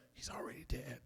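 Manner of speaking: a soft unvoiced whisper; whispered aside. Answering himself about a ghost, whispered. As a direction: whisper it like a scared realization. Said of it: "And I'm like".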